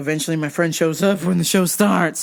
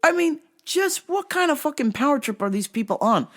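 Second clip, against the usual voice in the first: ordinary delivery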